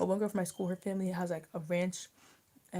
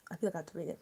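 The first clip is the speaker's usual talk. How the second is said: ordinary talk, nothing marked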